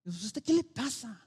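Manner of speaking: un toque de papá exasperado, más agudo; padre quejándose. Retold or imitated. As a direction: imita a un padre harto de su hijo. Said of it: "muchos papás nos preguntamos". (un padre se queja del hijo adolescente, levemente exasperado)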